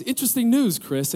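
imitating dad's upbeat, high-pitched announcement; cheery. Dad calls his son with news, cheery. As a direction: play a chipper dad delivering news. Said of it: "he's really cheering".

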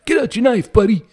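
high, hammed-up stage tough guy; Broadway knife fight. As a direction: ham it up like a musical rumble. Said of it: "Guys and dolls kinda".

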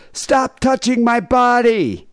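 high-pitched, drawn-out yell; mock yell. Demonstrating how to stop a groper on the train, mock yell. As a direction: cry out slowly and high, hammed up. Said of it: "is to yell".

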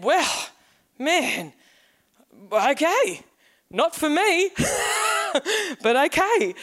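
lightly voiced amused bloke brushing it off; footy mate laughing it off; bemused teammate. Teammate shrugging off the belief.

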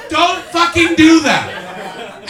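high, slow, yelled protest through a laugh; loud laughing protest. Real, not acted. Comic yelling at a crowd member who creeps him out, loud laughing protest. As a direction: yell it at him while cracking up. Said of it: "reacts to audience member".

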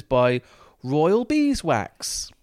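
drawn out, slow and grand; slow pompous announcing. Acted. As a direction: announce the name slowly and grandly. Reading reviewer name.

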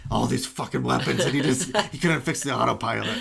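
loud, indignant comic tirade; hammed-up outraged griping; mock rant. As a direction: rant in disbelief, play-acting. Comic riff ranting about engineer.

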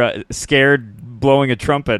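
ordinary delivery

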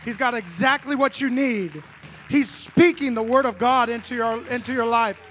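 loud, high, building sermon cry; loud preacher voicing the Holy Spirit's encouragement; preaching at full force. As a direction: pour it over the crowd with rising fervor.

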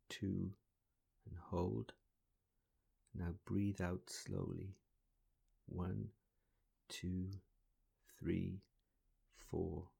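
pace the breaths gently, counting slowly. Acted.

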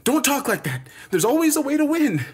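high-pitched, heated protest; frustrated anger. Acted. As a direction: refuse defeat angrily, stumbling on the words. Angrily refuses to accept defeat.

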